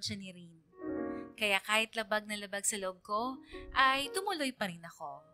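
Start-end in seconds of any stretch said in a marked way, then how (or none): none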